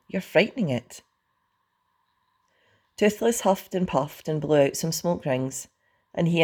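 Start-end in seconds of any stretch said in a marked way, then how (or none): 0.1-1.0 mildly exasperated, raised pitch; impatient. A narrator's reading. Hiccup protects the dragon, impatient with Fishlegs. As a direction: scold a friend with some impatience. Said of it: "said Hiccup impatiently".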